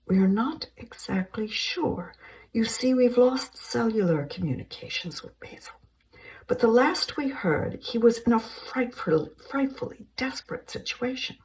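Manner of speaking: high, light, polite English lady; a prim British woman on the phone; British caller. Acted. Helen speaking.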